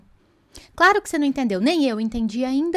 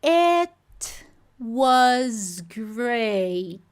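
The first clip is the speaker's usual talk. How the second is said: slow, separated words; much slower than usual. Shown as a demonstration. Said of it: "era pra eu tá ouvindo".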